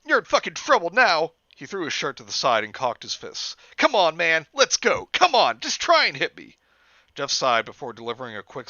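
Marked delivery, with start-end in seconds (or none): 0.0-1.3 tough guy taunt. Acted. Randy taunts, shirt off. 3.8-6.6 goading taunt. Acted. Randy goads Jeff to fight.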